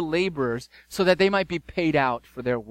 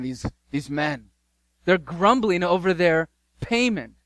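ordinary delivery

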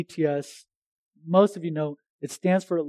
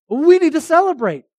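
excited exclaim; 10 semitones higher, 9 dB louder than usual. Retold or imitated. Pastors realizing missed anniversary.

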